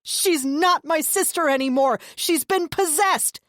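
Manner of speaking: high, loud, slow conviction; fanatical insistence. A narrator's reading. Deluded brother insisting his sister is possessed. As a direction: insist with wild certainty.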